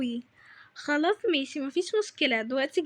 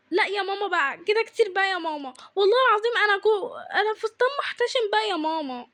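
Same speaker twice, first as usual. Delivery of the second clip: fed-up pleading, pitched up; whining protest; 4 semitones higher than usual. Acted. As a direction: plead in an exasperated whine. Objecting to mother's further demand.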